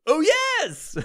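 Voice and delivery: high, loud mock-announcer reply; radio voice. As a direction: answer like a DJ's wife, hammed up. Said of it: "if you talk like that".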